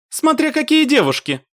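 put-on high-pitched, noncommittal girl's answer; evasive. A narrator's reading. Mashka answers a teasing question about girls, evasive. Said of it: "уклончиво ответила Машка".

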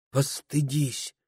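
soft, high, slow plea; meek reproach. A narrator's reading. Wife reproaching her husband, meek with restrained irritation. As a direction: hold irritation back, say it meekly. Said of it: "кротко сказала жена, сдерживая раздражение".

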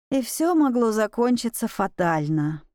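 ordinary delivery

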